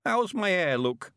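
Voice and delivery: put-on high, slow creaky old man voice; an eager old countryman, chuffed; old Mister Weevil.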